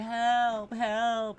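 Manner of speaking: high, loud mock cries for help; mock screaming. Imitating horror-movie victims trapped in a basement, mock screaming. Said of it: "Bitches screaming".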